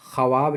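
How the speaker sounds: ख्वाब with the waw sounded, kha-waab; spelled-out reading. Shown as a demonstration. Teacher reads what is written, ख्वाब as kha-waab. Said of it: "लिखा हुआ है".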